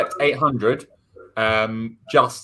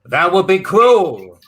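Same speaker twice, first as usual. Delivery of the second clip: mob boss; 3 semitones higher than usual. Acted. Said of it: "biggest mob boss in New York".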